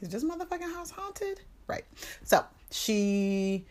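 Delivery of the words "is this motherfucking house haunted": high, soft incredulous question; spooked wondering. Acted. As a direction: wonder it in a squeaky hush. Wondering aloud at eerie events.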